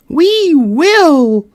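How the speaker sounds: high, loud squeak of eager mice; shrill mouse chorus. Acted. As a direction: cheer it as a crowd of tiny mice. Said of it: "cried all the mice in a shrill chorus".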